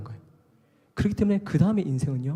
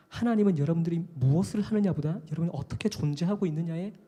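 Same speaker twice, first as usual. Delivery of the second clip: ordinary talk, nothing marked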